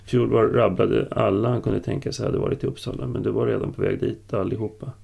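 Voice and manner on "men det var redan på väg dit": ordinary delivery, nothing marked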